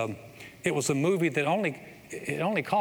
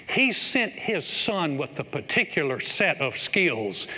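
slow, rising, louder preaching climax; preaching build; 3 semitones higher, 4 dB louder than usual. Acted. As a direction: build it up to the big payoff.

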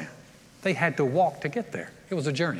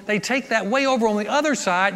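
normal talking voice

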